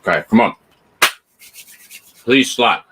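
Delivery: ordinary delivery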